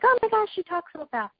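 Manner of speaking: high-pitched imitation of an astonished listener; gushing "oh my gosh" reaction, imitated; mock amazement.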